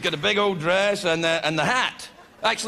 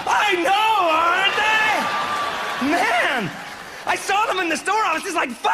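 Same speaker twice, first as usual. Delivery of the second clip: thrilled reply; 11 semitones higher, slower than usual. Acted. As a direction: gush back, delighted to be complimented. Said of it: "They're like".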